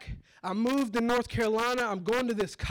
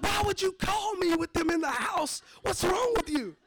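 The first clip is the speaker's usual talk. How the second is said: freaking out; 8 semitones higher than usual. Retold or imitated. Yelling in panic at grandma for calling with cops there. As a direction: cry out in alarm at grandma. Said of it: "So I'm freaking out".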